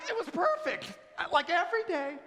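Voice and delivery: over-the-top chipper reply; gushing cheerful answer. Acted.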